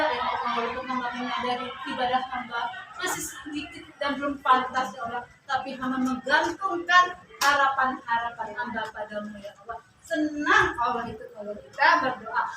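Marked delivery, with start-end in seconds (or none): 0.0-9.8 soft, fading humble plea to God; humble prayer. Shown as a demonstration. Preacher models a humble prayer for the elderly women, soft and fading. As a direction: pray it quietly, bowing in humility.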